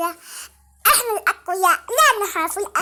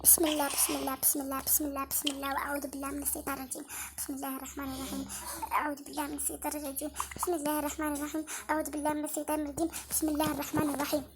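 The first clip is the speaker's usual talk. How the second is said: softer, low, fearful murmur of prayers; scared murmured prayer; 7 semitones lower, 17 dB quieter than usual. Real, not acted. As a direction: mutter the prayers under your breath, frightened. Alone at night, scared, murmuring prayers.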